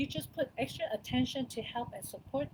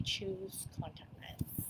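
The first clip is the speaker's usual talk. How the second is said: whisper; 3 semitones lower, 9 dB quieter, slower than usual. Real, not acted. Aside about own choice.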